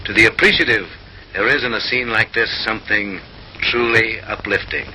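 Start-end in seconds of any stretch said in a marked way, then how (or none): none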